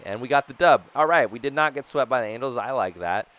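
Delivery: loud, high, quick celebration; happy to avoid the sweep; excited win. Real, not acted. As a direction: cheer the win fast and loud.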